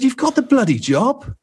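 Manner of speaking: very high-pitched exclamation; retold excited offer. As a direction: act out the boss's sudden job offer, voice leaping up. Retold instant job offer, punchline.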